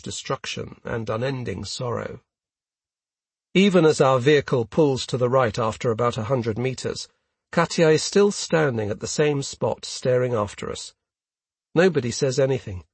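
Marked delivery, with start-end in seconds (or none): none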